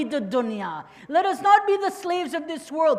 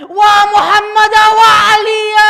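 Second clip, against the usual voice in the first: imitated yell; 4 semitones higher, 17 dB louder than usual. Preacher voicing Kulthum's lament to mourners, imitated yell. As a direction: cry the names out at full voice. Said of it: "Kulthum begins to yell".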